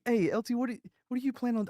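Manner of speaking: high, quick worried questioning; wary soldiers. Retold or imitated. Soldiers asking the lieutenant about his plan, high and uneasy. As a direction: ask nervously, half laughing at him.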